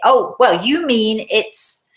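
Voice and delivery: loud, high interjection; drunken posh lady. Retold or imitated. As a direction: butt in loudly and slowly as the tipsy lady. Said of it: "a certain lady in the church who is drunk".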